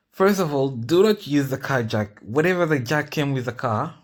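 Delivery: loud, high, urgent warning to viewers. Real, not acted. Safety warning about the jack.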